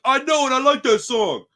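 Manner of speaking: high, loud mock comeback; hammed-up defiant retort; defiant reply. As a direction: bounce the complaint back cheekily. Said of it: "I'm like".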